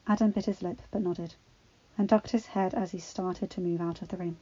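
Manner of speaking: ordinary delivery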